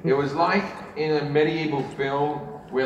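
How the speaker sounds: ordinary delivery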